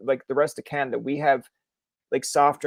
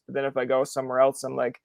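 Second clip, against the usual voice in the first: ordinary delivery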